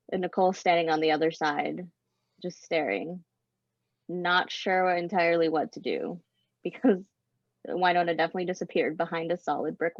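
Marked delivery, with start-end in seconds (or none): none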